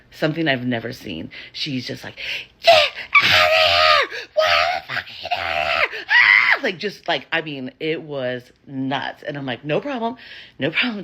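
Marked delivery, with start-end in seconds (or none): 2.6-6.6 loud, drawn-out imitation of a furious scream; hammed-up shrieking fury; enraged screaming. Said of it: "the rage and anger that comes out of her". 9.6-11.0 high, placating retold reassurance; smooth it over in a raised pitch; acted-out hasty appeasing; de-escalating. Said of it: "I'm trying to de-escalate it".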